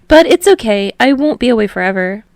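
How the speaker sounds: put-on high, loud reassuring boy; Hinata, brave voice. A narrator's reading. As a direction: voice Hinata putting on a brave face.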